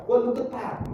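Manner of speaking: high-pitched exclaimed "ketat", that read with a T; mock misreading. Shown as a demonstration. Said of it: "ini jangan dibaca".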